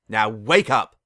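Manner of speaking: loud order; a princess's loud command; commanding shout. A narrator's reading. Luna commands her awake.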